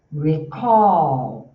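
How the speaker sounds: Recall, loud, punch the ALL; stress on all. Shown as a demonstration. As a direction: put the energy on ALL in Recall. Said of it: "Olha só o meu all, ele tem energia". (ênfase forte no ALL de Recall)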